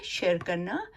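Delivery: normal talking voice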